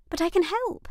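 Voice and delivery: put-on high, hurt girlish voice; a younger sister stung at being left out; young sister Lizzie. A narrator's reading. Sister asks Nora why she kept it secret, high and hurt.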